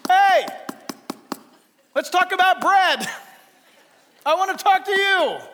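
high, loud acted call; God calling out. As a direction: holler through the door for someone's attention. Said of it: "God will knock on our door".

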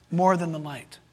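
ordinary delivery

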